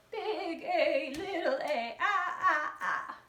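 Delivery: lightly voiced sing-song grandma. Shown as a demonstration. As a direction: play a doting grandma. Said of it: "let's do a grandma voice".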